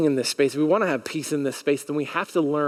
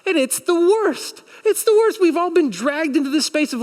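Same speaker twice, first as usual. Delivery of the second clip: much higher and louder, fed-up preaching; loud exasperation; 8 semitones higher, 4 dB louder than usual. Real, not acted. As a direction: rail against it from the pulpit. Preacher venting about covid.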